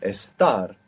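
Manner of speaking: "Estar" stressed on TAR; stress on the end. Shown as a demonstration. Teacher echoing student's answer, last syllable stressed in "Estar". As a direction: land on the final syllable of "Estar". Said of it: "accent is coming on the end".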